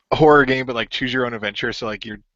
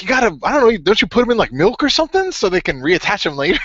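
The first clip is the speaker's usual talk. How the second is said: urgent advice; 10 semitones higher, 5 dB louder than usual. Retold or imitated. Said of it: "Austin Creed's like".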